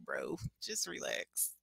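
soft, slow, unbothered imitation; retold calm husband. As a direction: play him hushed and unhurried. Husband's calm answer to her drama.

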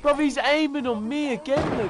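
high, loud panicked shout; panicked yell. Real, not acted. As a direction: yell it as you're under fire. Opponent aiming at him.

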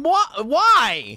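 high, loud disbelief; incredulous yell. Real, not acted. Gamer questioning a sniper's loadout, incredulous yell.

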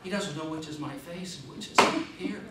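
ordinary talk, nothing marked

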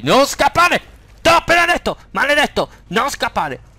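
loud, high shout after the fleeing beast; loud high angry command; furious yell. Real, not acted. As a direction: scream at it to stay. Yelling at fleeing Gyarados.

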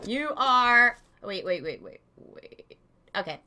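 ordinary delivery

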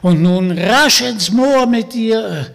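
high, loud witch order; commanding witch. Acted. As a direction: bark it like a triumphant hag. Hexe befiehlt Vanya. (lauter Hexenbefehl)

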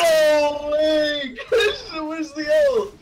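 high, loud, laughing protest; incredulous shout. Real, not acted. Player asking teammate for the ult, incredulous shout. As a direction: wail it out in disbelief.

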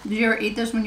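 chewing while talking; talking between bites; mouth full. Real, not acted. Seen 1: Woman puts snack piece to mouth, talks while eating it.

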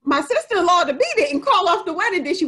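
a sudden scare about the wedding; retold worried disbelief; alarmed question. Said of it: "And so my heart dropped".

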